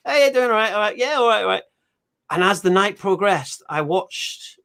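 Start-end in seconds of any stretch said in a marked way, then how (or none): 0.1-1.6 greeting locals. Retold or imitated. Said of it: "they all come in".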